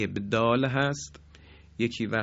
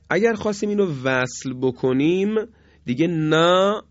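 ordinary talk

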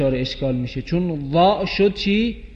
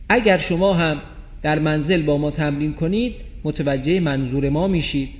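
normal talking voice

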